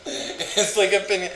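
high, giggling Canada with the wavy N; amused giggling repeat; laughing through the word. Real, not acted. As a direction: laugh it out while saying it.

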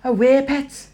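high-pitched Geordie attempt; Geordie accent. Shown as a demonstration. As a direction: put on an exaggerated Geordie lilt. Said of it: "do a Geordie accent".

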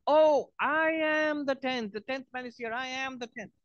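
sudden joyful recognition, drawn out; joyful realisation. Acted. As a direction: stretch the "Oh" out in delighted wonder. Man realises he is tenth.

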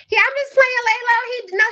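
ordinary talk, nothing marked

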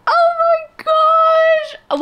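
moved to happy tears, drawn-out cry; teary gasp. Real, not acted. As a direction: stretch it out, voice breaking with emotion. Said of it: "Why am I tearing up?".

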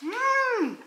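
high pleased hum of taste; yummy mm. Real, not acted. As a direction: savour it with a high mm. Tasting the salad.

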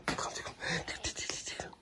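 soft, breathy, playful whisper; whispered tickle game. Real, not acted.

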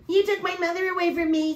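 high, whiny mock accusation; mock screaming sibling. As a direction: parody the sister's shrill complaint. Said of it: "screaming and yelling".

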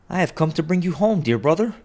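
a little sister bursting with joy; little girl calling her brother home, high and gleeful. A narrator's reading. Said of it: "clapping her tiny hands".

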